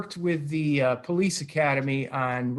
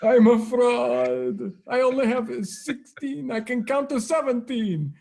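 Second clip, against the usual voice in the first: Count voice; 5 semitones higher than usual. Pretend. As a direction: ham up Sesame Street's Count.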